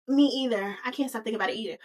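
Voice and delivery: soft, understated confession; Fifi. Acted. Fifi locking eyes with McDreamy, softly confessing. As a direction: answer quietly in a natural pitch, dropping away. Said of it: "And she's like".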